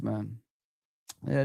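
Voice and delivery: normal talking voice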